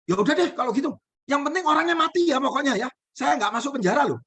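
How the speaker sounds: high, eager wife agreeing; wife's voice. Acted. Wife agreeing to the shaman's plan, high and eager.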